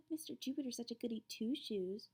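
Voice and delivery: whispered. A narrator's reading. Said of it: "Missy whispered to Rose".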